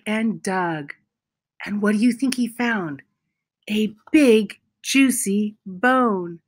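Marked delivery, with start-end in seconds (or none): none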